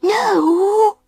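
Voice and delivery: very high small voice; little beaver voice. A narrator's reading. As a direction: squeak it high and loud. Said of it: "said Baby Bee's voice".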